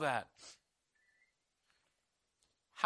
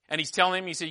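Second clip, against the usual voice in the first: usual speaking voice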